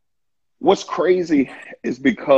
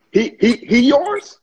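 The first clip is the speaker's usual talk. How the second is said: higher, louder taunting Satan; Satan mocking the believer to God, higher and louder; 6 semitones higher, 5 dB louder than usual. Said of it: "hear Satan going to God and say".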